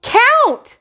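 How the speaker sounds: put-on high, loud childish yell; toddler shout. Fudge shouts at the dentist, high loud yell. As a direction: shout it like a demanding little kid. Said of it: "Fudge shouted".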